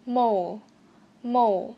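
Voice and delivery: "Mole" with the tongue curled on the L; curled final L. Shown as a demonstration. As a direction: finish "Mole" on a clearly formed L. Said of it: "Phát âm chữ L uốn lưỡi một chút". ("Mole" uốn lưỡi ở âm L cuối)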